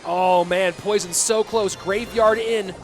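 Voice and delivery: energetic esports shoutcast; live play-by-play. Real, not acted. Esports caster calling the end of a game, play-by-play. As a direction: call the match live with punchy emphasis.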